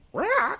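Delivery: very high parrot screech; parrot squawk. Acted. Parrot answering the store owner, squawking. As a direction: give a parrot's squawked reply. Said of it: "goes to the parrot".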